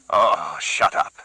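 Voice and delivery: tired, slow, breathy dismissal; weary. Acted. Gerardo silencing Carlos, weary. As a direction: brush him off, exhausted. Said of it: "Gerardo said wearily".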